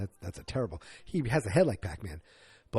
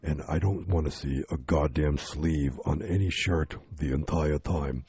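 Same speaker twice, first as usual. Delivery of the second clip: much lower, slow gruff action-star voice; Vin Diesel impression; 9 semitones lower, slower than usual. Star dictating contract demands, low slow mock voice. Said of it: "he's like".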